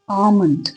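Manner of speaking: आमंड said AA-mund, silent L; correct pronunciation. Shown as a demonstration. Teacher giving the actual correct form, silent L. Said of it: "correct pronunciation है वो क्या होती है".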